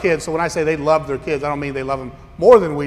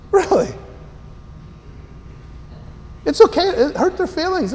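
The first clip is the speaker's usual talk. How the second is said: much higher, exaggerated disbelief; sarcastic squeaky really; mock incredulous; 11 semitones higher than usual. Real, not acted. Mocking incredulity.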